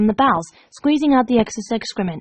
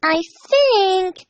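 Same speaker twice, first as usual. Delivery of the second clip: much higher musing voice; 9 semitones higher than usual. Acted. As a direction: muse brightly. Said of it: "Pinkie Pie mused".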